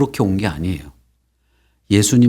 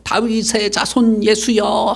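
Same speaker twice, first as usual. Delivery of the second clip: high, drawn-out plea calling to Jesus; retold crying out; 11 semitones higher, slower than usual. Leper calls to Jesus for mercy, high retold cry. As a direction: call out slowly, pitched well up. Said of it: "소리를 질렀어".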